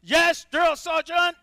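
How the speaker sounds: high, loud recruit reply; barked military recruit reply. Acted. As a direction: snap back at the sergeant. Recruit answering sergeant.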